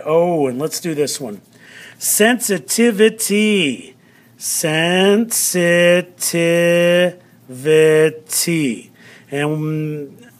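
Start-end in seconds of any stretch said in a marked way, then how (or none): 2.0-4.0 "Sensitivity" slow and higher, every syllable clear; teacher presenting "Sensitivity" slowly; sounded-out word. Shown as a demonstration. 4.4-8.9 "Sensitivity" in very slow, sustained syllables; drawn-out classroom sounding-out of "Sensitivity". Shown as a demonstration.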